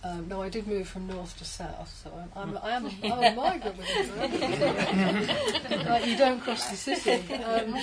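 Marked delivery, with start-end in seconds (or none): 3.1-7.8 laughing. Real, not acted. Jokes about moving within Birmingham.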